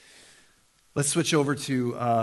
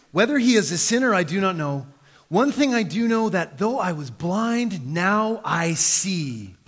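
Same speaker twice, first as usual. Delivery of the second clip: louder, higher, then slowing right down; preaching the climax; 4 semitones higher, 4 dB louder than usual. Acted. Climactic scripture reading.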